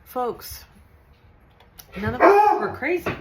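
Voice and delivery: high, loud declaration to the audience; emphatic. Real, not acted. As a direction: proclaim it big and high.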